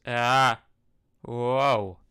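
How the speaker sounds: loud sudden surprised yelp; startled exclamation. Real, not acted. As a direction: jump at your own mistake. Startled by his own slip.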